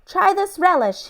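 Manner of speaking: high, called out from the truck. A narrator's reading. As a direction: call it over from the truck. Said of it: "he called".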